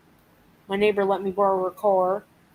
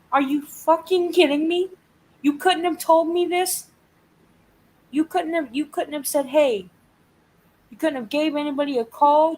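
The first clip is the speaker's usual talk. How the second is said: higher, louder, exasperated disbelief; a fed-up caller venting at the office that never called, higher and louder in exasperated disbelief; retold pissed-off rant; 6 semitones higher, 3 dB louder than usual. Retelling her rant at the office, higher and louder, exasperated. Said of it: "I was so pissed off that day".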